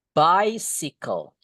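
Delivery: BICYCLE slowly, "BAI-si-kol"; model pronunciation. Shown as a demonstration. Teacher showing how it is read, slow drawn-out BICYCLE. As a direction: stretch out BICYCLE, short "si" in the middle. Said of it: "Ingat bacanya gini".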